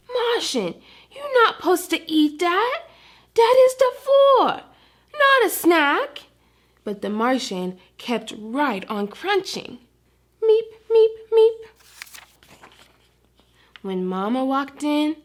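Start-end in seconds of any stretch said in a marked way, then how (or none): 0.1-6.2 a small dog scolding, pitched high; exasperated puppy. Acted. Frosty scolding the Martian for eating the floor, high and exasperated. 10.4-11.6 alien meep. Acted. Martian's crunching noise.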